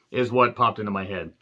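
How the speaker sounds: normal talking voice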